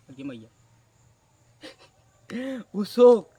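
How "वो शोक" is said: loud laugh breaking into the words; burst of laughter. Real, not acted. Narrator laughing loudly about the shopkeeper's shock. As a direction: laugh hard, then get the words out. Laughing while telling the story.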